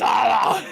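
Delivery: loud, high mock scream; hammed-up open-throated scream. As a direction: scream at full volume in play. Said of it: "just screaming at him".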